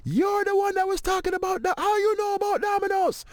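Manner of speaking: very high-pitched imitation of excited strangers; excited accosting. Airport strangers recognizing the domino comedian, excited and high. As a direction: pounce on someone you recognize, high and insistent.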